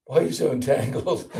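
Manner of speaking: hammed-up tactless question breaking into laughter; laughing mock reproach. Preacher to a family man on the street, mock reproach.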